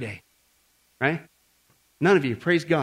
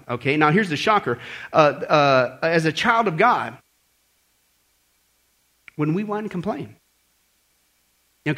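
usual speaking voice

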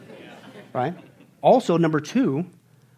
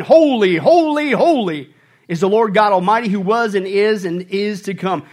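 angels' loud praise; 3 semitones higher, 8 dB louder than usual. Retold or imitated. Living creatures crying out to God, loud proclamation. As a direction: cry it out like creatures before the throne. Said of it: "they never stopped saying".

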